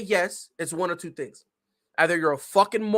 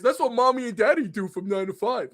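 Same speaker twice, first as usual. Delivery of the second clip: mock dumb fan. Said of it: "If you ask them".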